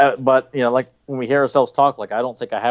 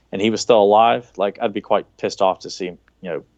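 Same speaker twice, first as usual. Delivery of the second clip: ordinary delivery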